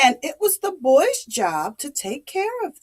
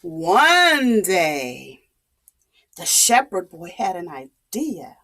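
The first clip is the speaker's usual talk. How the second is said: plain reading voice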